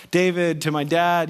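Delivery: usual speaking voice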